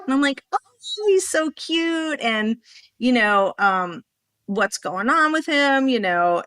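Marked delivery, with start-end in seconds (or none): 0.5-2.2 gushing. Retold or imitated. Gushing over puppy photo.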